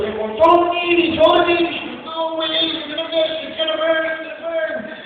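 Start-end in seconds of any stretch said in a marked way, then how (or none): 0.4-1.7 high-pitched mock crowd holler; crowd urging. Crowd below egging on the mother, high calling. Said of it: "Now the crowd went into it". 2.0-4.9 high mock wail; panicked protest. Trapped mother refusing to throw baby, high panic.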